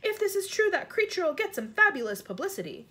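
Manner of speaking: high, lively exclamation; excited storybook cry. A narrator's reading. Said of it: "no less than fifty-two policemen cried".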